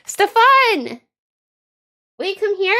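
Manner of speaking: loud high call across the house. Real, not acted. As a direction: shout his name to another room. Calling grandpa from another room.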